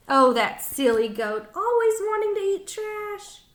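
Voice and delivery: ordinary delivery, nothing marked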